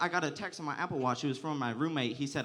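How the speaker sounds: ordinary talk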